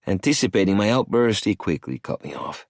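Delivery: plain reading voice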